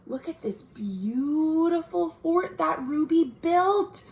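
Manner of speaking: plain reading voice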